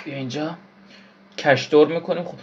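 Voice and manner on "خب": ordinary talk, nothing marked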